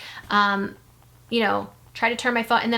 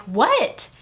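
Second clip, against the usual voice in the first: irritated, sharply rising what; annoyed; 4 semitones higher, 3 dB louder than usual. Retold or imitated. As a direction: throw it back as an exasperated question. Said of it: "really annoyed".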